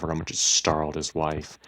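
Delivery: usual speaking voice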